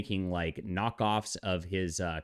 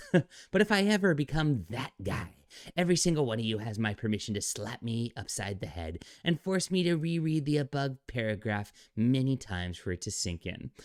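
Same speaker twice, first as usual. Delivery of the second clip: higher, sneering recital of the post; mock pompous reading; 4 semitones higher than usual. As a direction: ham up the smug tone of the post. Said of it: "They always talk like this".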